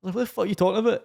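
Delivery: high, baffled protest; indignant confusion. Retold or imitated. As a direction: push back, baffled and annoyed. Arguing with the man.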